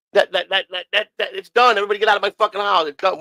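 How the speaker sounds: high, rapid shooing-out; mock fed-up host clearing the house; retold impatient dismissal. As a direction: stammer it urgently, then rush them out. Retold kicking everyone out.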